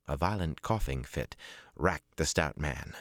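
ordinary delivery, nothing marked

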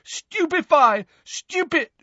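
frantic, very high spell shouts; Neville. A narrator's reading. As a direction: fire off the spell in high yells. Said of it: "Neville shouted again".

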